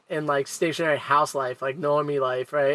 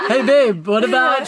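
chirpy mock chatter; 8 semitones higher, 5 dB louder than usual.

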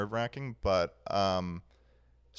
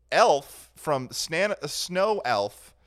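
higher, louder and slow, amused disbelief; excited; 7 semitones higher, 9 dB louder, slower than usual. Real, not acted. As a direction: stretch it out, loud and incredulous. Exaggerated retelling of the silly answer.

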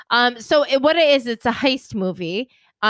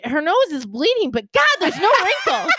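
mock hairdresser gushing; 6 semitones higher than usual.

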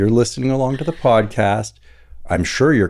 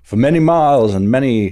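loud, higher mock Southern storyteller; Southern accent; 5 semitones higher, 4 dB louder than usual. Hamming up the family's county history, Southern accent. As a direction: proclaim it in a hammed-up Southern accent.

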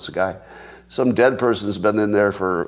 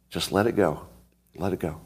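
soft letting go; 4 semitones lower, 7 dB quieter than usual. Pretend. Soft self-soothing aside.

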